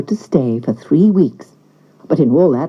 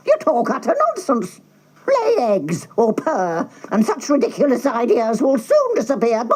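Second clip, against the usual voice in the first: hen scolding; 5 semitones higher, 4 dB quieter than usual. A narrator's reading. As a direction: lecture him like a bossy hen. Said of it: "said the hen".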